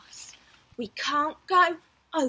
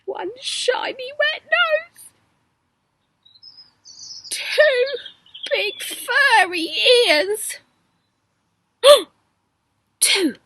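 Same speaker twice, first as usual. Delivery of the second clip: loud, higher, growing alarm; rising alarm; 6 semitones higher, 9 dB louder than usual. A narrator's reading. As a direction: reveal it louder and louder. Discovering the bear.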